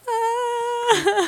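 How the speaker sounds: sudden very high startled squeak; mock alarmed cry. Reacting to a creepy fan tweet, high startled yelp. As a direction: yelp in pretend fright.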